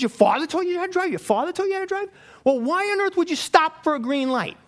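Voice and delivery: high-pitched, incredulous questioning; acted-out exasperated disbelief; incredulous outrage. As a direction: throw it back at the driver, appalled. Mad passenger questions driver in disbelief.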